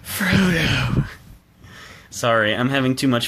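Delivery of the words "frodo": higher, breathy, drawn-out swoon on the name; Arwen impression. Arwen calling out to Frodo: breathy, higher dramatic swoon. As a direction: sigh the name like an elf princess. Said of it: "they see me going".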